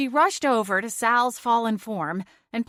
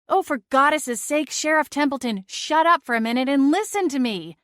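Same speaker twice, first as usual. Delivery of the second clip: loud, higher snapping outburst at the sheriff; exasperated outburst; 6 semitones higher, 4 dB louder than usual. A narrator's reading. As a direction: finally lose patience and yell him down. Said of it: "I shouldn't have screamed at him".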